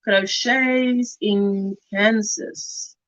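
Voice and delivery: crochets in Kansas said very slowly, word by word; slow and deliberate. Shown as a demonstration. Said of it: "the Michelle that is crochets in Kansas".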